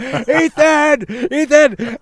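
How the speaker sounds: high, loud name-calling; excited shouting. Retold or imitated. Happy surfer calling to bad-tempered friend, excited shout. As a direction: keep hollering the name, oblivious and thrilled. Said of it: "And I'm shouting at him".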